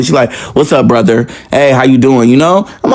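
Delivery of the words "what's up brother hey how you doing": low, offhand nonchalant greeting. Retold or imitated. Sister opens the door to her brother, nonchalant. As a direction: greet him like it's no big deal, pitched low. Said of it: "real nonchalantly".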